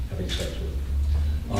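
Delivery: sheepish whispered aside. Real, not acted. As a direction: drop to a whisper. Embarrassed aside after throat clear.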